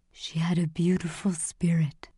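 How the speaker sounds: breathy, subdued tribute; soft, whispery condolence; hushed mourning. A narrator's reading. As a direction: say it gently in grief. Old man mourns the dead mother.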